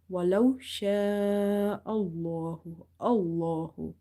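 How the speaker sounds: Allahu with a dark, full l and long vowel; heavy lam. Shown as a demonstration. Teacher demonstrates the rule, heavy-lam recitation. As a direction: recite slowly, sha'a held long, thick l in Allahu. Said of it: "So lam will be heavy".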